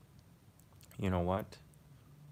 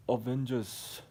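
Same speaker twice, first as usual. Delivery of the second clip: African-accented film hero impression; mock-heroic call in an African accent; 4 semitones higher, slower than usual. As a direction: put on an African accent, slightly higher.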